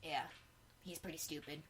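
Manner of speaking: ordinary talk, nothing marked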